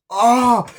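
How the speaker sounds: high, loud mock scream; imitated scream. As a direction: scream as if metal flies at you, hammed up. Imitates metal flying at him.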